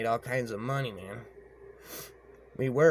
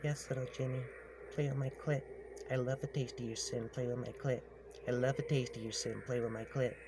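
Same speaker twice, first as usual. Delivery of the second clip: whispering witch; 2 semitones higher, 6 dB quieter than usual. Retold or imitated. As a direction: purr it to him like a witch. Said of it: "She was whispering to me".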